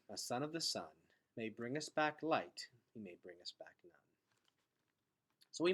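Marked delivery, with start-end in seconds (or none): none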